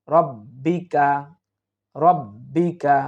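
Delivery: রব্বিকা said with a short rounded রো sound and a doubled b, slow; correct short ra. Shown as a demonstration. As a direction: say রব্বিকা slowly, keeping the first vowel short and rounded. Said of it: "এই শব্দটি আমরা অনেকেই উচ্চারণ করতে গিয়ে".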